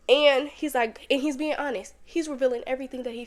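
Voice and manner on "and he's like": normal talking voice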